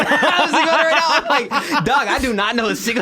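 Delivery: high, laughing confession. Real, not acted. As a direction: laugh through the words. Laughing while admitting.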